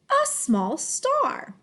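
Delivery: slow, lifted "A small star"; expressive drawn-out "A small star"; smooth phrase reading. Shown as a demonstration. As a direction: start high and glide through "A small star". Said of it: "read smoothly in phrases".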